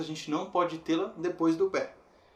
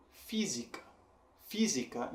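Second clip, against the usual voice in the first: "física" with the first syllable "fi" stressed; 6 dB quieter than usual. Shown as a demonstration. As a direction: lean on "fi" in "física", let it fall. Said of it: "em que fi". ("física" com "fi" forte)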